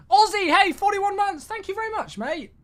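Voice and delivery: high, bright burst of thanks to a subscriber; shout-out a loyal viewer, pitch way up; excited thanks. Real, not acted.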